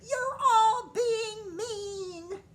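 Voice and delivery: very high, loud, slow wailing scream; crying scream. A narrator's reading. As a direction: scream it through tears. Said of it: "screamed the queen".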